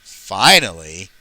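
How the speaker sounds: slow storybook narrator with big pitch swoops; Michael Brandon. Acted. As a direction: narrate the train story in a drawn-out, put-on voice. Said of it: "I am Michael Brandon".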